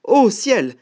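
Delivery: high, loud cry of alarm; startled exclamation. Acted. Snake seeing the gruffalo, loud high alarm. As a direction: exclaim in sudden fright. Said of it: "s'exclama-t-il".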